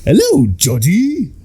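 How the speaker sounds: high, slow singsong clown greeting; sweet creepy clown voice, drawn out; Tim Curry Pennywise. Retold or imitated. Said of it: "Tim Curry's Pennywise is just like".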